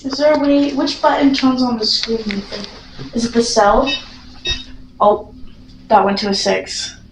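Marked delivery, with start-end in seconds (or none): none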